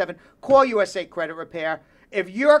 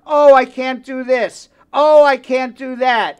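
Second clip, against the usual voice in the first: mock whining; 3 semitones higher, 8 dB louder, slower than usual. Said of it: "feel sorry for themselves and they say".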